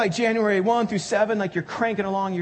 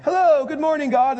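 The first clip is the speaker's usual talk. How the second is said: much higher and louder, sing-song cheerful mock greeting; chirpy morning greeting; 9 semitones higher, 7 dB louder than usual. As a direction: play an overly perky early riser greeting God. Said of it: "and they're like".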